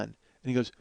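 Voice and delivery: usual speaking voice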